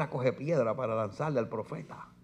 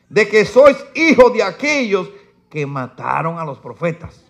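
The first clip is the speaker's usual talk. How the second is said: loud, high, slow pulpit reading; fiery preaching; 5 semitones higher, 12 dB louder, slower than usual. Acted. Preacher reading woes to his church, fiery and loud. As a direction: thunder the verses at the congregation.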